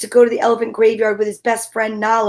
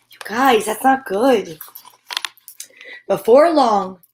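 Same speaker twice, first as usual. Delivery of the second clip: usual speaking voice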